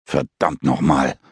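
low, slow growled curse; cursing. Acted. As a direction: curse under the breath, stunned. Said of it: "fluchte Utz". (leise, tiefer Fluch)

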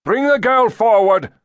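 high, loud command; shouted order. A narrator's reading. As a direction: order them sharply at full voice. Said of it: "Lazarus ordered".

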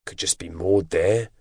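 slow, musing drawn-out delivery; unhurried pondering; thoughtful. A narrator's reading. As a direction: muse it slowly, weighing it up. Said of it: "George said thoughtfully".

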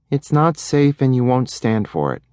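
lightly voiced boy reciting rules; small boy repeating mom's rule; reluctant boy. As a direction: say it like a chore.

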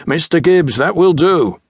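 put-on a lieutenant silencing an old sailor, commanding; commanding call. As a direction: cut him off with naval authority. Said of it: "a commanding voice called out".

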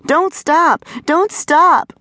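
high-pitched mock hollering; imitated hollering. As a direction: mimic a woman crying out through a door. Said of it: "I heard her through the door hollering".

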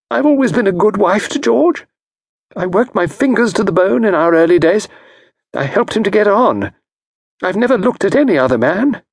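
a wronged wife complaining; aggrieved wife. Acted. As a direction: pour out her grievance. Mrs Packington lament.

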